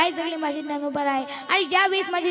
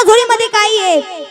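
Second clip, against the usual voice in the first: sharp, louder bullying demand; harsh demand; 6 semitones higher, 12 dB louder than usual. Acted. Bully grabbing at the boy, harsh loud demand. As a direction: bark at the child to show the bag.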